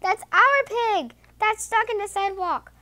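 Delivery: high-pitched storybook alarm; worried wife crying out. A narrator's reading. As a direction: exclaim it high, as if shocked. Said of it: "cries the farmer's wife".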